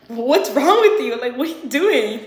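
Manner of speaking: retold outraged challenge; indignant confrontation. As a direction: confront him loudly, stretching it out. Retells her reaction to him.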